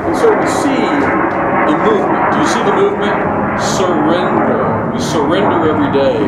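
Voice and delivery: loud, high closing exhortation; rally the room to surrender; preaching at full force. Acted. Closing call to the congregation.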